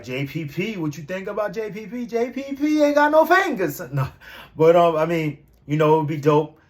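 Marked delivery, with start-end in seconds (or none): 0.0-3.8 mock friend voice. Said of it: "He's like".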